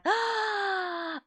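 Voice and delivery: high sharp gasp of horror; real startled gasp; shocked gasp. Real, not acted. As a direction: gasp as it sinks in. Reacting to landing gear fact.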